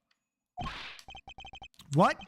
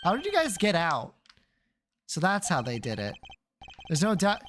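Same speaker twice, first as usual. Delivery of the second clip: ordinary talk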